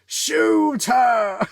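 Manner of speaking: very high, loud yell; shouted order. Acted. Desperate villain ordering allies to fire, high shout. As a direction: scream the command.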